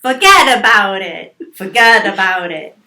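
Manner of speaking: loud, hammed-up Italian-American accent. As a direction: belt it out with some Italian on it. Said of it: "a little bit of Italian on there".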